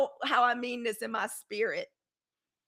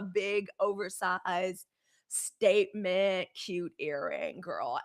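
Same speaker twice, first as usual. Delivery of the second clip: slow, lower, softer drawn-out words; slow savoring; 6 semitones lower, 5 dB quieter, much slower than usual. Real, not acted. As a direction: stretch each word out lovingly. Savoring describing big earrings.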